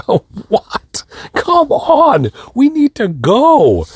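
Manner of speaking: very high, strained protest; exasperated whine. Retold or imitated. Husband in the shower needs to go, exasperated. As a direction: whine it in retold disbelief, stretching the end.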